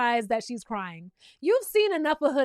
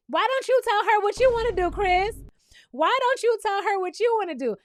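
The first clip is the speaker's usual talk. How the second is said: higher, louder turned-back question; indignant challenge; 7 semitones higher, 4 dB louder than usual. Real, not acted. Host challenging Chris on his own demand, indignant. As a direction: throw the question back at him, pitched up.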